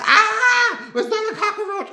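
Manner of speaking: a high, loud, staged shriek at a cockroach; fake scream. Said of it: "I screamed real loud".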